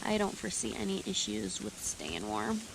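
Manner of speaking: normal talking voice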